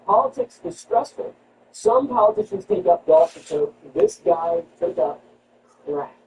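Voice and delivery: normal talking voice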